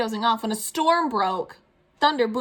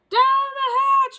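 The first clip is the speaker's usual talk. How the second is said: very high, loud, slow cheer; the whole crew bellowing; crew shout; 9 dB louder, much slower than usual. Acted. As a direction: crew echoing the toast. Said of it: "we all shouted".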